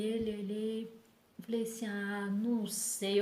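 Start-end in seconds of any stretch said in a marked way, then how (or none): 1.9-3.2 slow, drawn-out doubtful reply; reluctant, slow "não sei"; hesitant. Retold or imitated. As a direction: stall on "Ah, não sei" as if unsure. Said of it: "Eu fiquei muito em dúvida".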